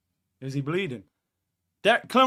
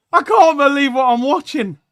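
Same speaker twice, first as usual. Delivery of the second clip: raised, high, amazed cry; loud disbelief; 8 semitones higher, 10 dB louder than usual. Real, not acted. Fan watching Madrid get beaten, loud disbelief. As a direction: belt out your amazement. Stunned after the goal.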